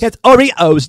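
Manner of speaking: put-on a lightly done, teasing British accent on "It's Oreos"; mock British accent. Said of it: "With your terrible British accent".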